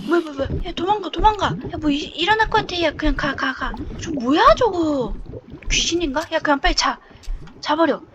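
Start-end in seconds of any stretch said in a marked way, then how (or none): none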